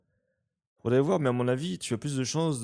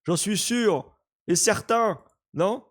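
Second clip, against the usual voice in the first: usual speaking voice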